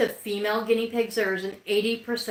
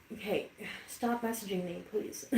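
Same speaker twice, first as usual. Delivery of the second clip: muttered aside; 10 dB quieter than usual. Real, not acted.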